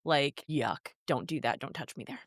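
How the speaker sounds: disgusted. Real, not acted. Cringing at an overshared detail.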